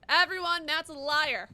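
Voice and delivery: loud announcement to all; loud accusation. Real, not acted. Player denouncing a viewer to chat, loud accusation. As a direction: call it out loudly to everyone.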